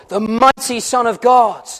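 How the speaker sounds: ordinary talk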